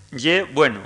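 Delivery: ye bueno with a y-like ye; dialect form. Shown as a demonstration. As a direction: lecturer cites ye bueno as locals say it. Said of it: "Si se dice, por ejemplo".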